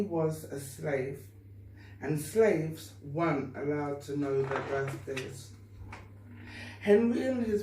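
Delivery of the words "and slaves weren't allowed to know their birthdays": plain reading voice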